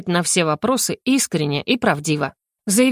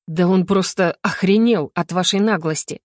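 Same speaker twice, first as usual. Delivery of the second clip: usual speaking voice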